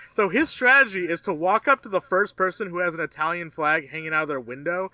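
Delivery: ordinary delivery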